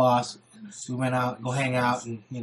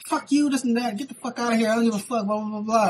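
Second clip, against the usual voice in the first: bickering woman; 7 semitones higher than usual. Retold or imitated. Girl tells the dude to get out, bickering. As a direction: imitate her bickering in a high voice. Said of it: "they was bickering".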